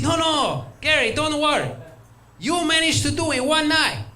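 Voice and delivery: high, joking host father reply. Retold or imitated. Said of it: "He said".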